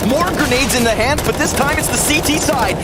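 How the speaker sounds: live play-by-play. Real, not acted. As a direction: call the round live with rising hype. Casts round live.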